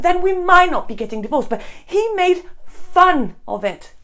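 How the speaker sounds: loud, high-pitched accusation; hurt indignation. Acted. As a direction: raise the voice in aggrieved protest. Wife accusing husband over divorce.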